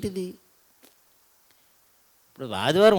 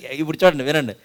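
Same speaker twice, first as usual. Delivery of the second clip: ordinary talk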